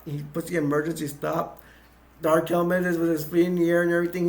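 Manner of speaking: ordinary delivery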